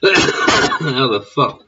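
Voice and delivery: an incredulous, slowed-down gasp of a question; stunned disbelief. Real, not acted. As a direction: drag it out in utter disbelief. Disbelief at Biden's face.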